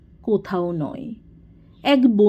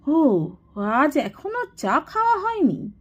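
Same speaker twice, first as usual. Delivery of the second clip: higher, swooping indignant question; pent-up anger; 7 semitones higher than usual. A narrator's reading. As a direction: vent built-up anger on the tea. ক্রোধ পড়ল গিয়ে চায়ের ওপর. (জমা রাগে চড়া গলার প্রশ্ন)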